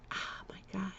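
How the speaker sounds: whispered under the breath; a soft voiceless oh my God. Real, not acted. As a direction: let it slip out as a whisper. Recalling an awful time.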